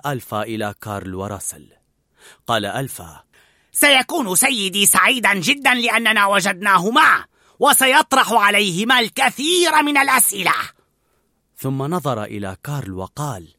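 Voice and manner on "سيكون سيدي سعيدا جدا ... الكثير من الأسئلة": loud, higher, gloating dog voice; a leader pleased with his catch; loud triumphant sneer; gloating pack leader. A narrator's reading. Narrator voices Alpha.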